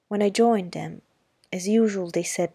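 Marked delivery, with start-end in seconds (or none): none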